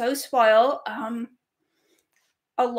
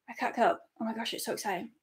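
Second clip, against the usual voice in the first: breathless hush; 3 semitones lower, 9 dB quieter, much faster than usual. Real, not acted.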